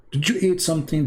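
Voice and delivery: ordinary talk, nothing marked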